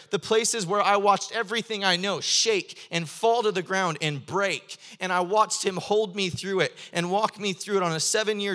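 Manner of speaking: loud, rising sermon delivery; impassioned preaching. Acted. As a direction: preach it with full fervor, building. Preaching at full force.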